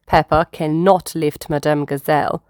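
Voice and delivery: ordinary delivery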